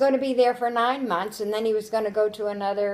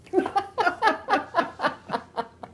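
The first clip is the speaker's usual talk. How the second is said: long high delighted laugh; fond amused laughter; real laugh; 10 semitones higher than usual. Real, not acted. Laughs at end of story.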